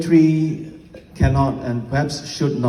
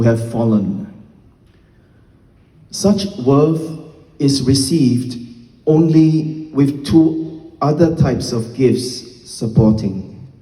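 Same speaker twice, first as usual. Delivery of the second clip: slow, deliberate verse reading with pauses between phrases; measured recital, each phrase set apart and weighted; poem recitation; slower than usual. Acted. Poet reading a sonnet to an evening audience, slow formal recitation.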